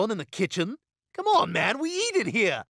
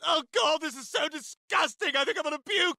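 disgusted whine; 9 semitones higher than usual. Acted. Disgusted by diaper.

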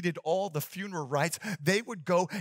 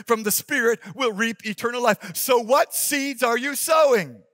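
loud, fervent preacher voice; preaching; 4 semitones higher, 6 dB louder than usual. Acted. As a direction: preach it with full force. Preaching at full force.

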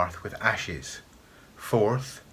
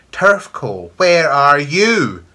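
very loud call, drawn out and climbing; long, forceful shriek; screaming witch voice; 6 semitones higher, 11 dB louder, much slower than usual. A narrator's reading. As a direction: scream it, stretching the question. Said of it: "screamed the voice".

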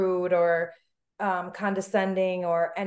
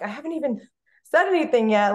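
pitched up, then louder, exasperated; indignant protest; 5 semitones higher, 5 dB louder than usual. Retold or imitated. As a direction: protest your innocence, rising in disbelief. Defending herself against judging looks.

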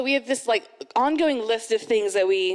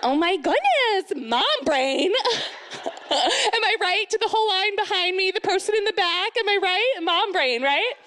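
high, over-jolly embarrassed mom; hammed-up embarrassment, pitched way up; flustered mom; 8 semitones higher than usual. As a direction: laugh it off to the whole queue. Acted flustered mom excusing herself.

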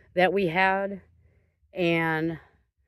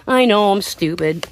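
ordinary delivery

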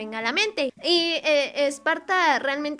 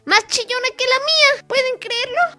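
squeaky; 10 semitones higher, 4 dB louder than usual. Real, not acted. Describing Esparta's squeaky voice.

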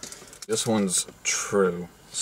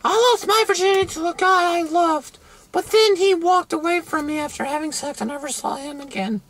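high-pitched mock girl voice. Host parodying girls who regret losing their virginity, mock girl voice. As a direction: squeal it in falsetto as a girl. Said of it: "especially the girls".

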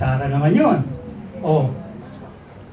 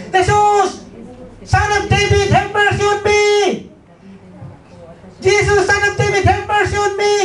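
high, loud pleading cry for mercy; desperate shout; 3 dB louder than usual. Acted. Blind beggar cries out to Jesus for mercy, high loud plea. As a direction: call out over the crowd, begging. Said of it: "Sumisigaw siya".

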